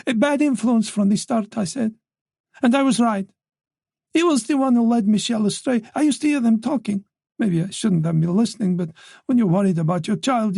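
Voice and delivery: a mother's grievance, lifted and insistent; voiced wife in full flow, pressing on. Wife grows defensive.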